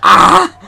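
sharp yelp of pain; pained cry. Real, not acted. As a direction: cry out as claws sink in. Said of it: "clawing the fuck out of my thighs".

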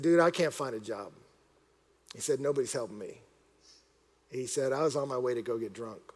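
low, soft, heavy-hearted; understated imitation of a crying man; retold tearful confession. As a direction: confess it quietly, downcast. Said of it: "he starts crying".

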